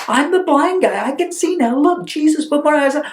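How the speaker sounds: high, eager protest; excited insisting. Acted. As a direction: insist excitedly that it's you. Healed man insists it's him.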